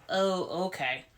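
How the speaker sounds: a hushed, knowing "oh, okay"; quiet. Retold or imitated. Mother quietly taking in news.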